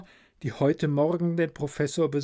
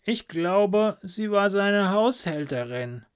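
put-on high croaking young man; croaky voice; 7 semitones higher than usual. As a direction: croak the answer out nervously. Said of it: "Franz antwortete mit krächzender Stimme".

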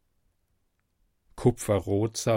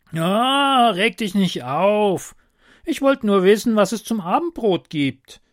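high, loud kobold voice; high-pitched kobold brushing off a scolding; cheeky kobold, placating; 9 semitones higher, 6 dB louder than usual. Acted. (hoher, frecher Kobold, beschwichtigend)

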